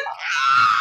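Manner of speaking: sudden loud low cry of surprise; shocked scream. Retold or imitated. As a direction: let out a burst of shock. Reacting to announcement. (grito de sorpresa)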